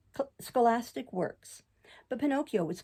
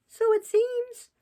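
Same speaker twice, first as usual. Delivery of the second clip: slow high puppet reply; 14 semitones higher, 7 dB louder, much slower than usual. A narrator's reading. Pinocchio answers carabineers.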